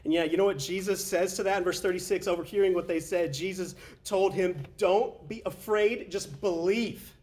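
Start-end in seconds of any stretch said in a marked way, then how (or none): 4.8-7.1 very slow and higher, each word stressed; slow emphatic. Acted. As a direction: lean into every word, slowly. Jesus's words, slow emphatic.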